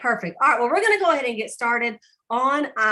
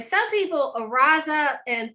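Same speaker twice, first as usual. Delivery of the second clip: half-hearted crying sound; mock whimper; 6 semitones higher, 4 dB louder than usual. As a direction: whimper it like a grumpy wake-up.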